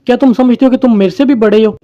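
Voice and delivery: ordinary talk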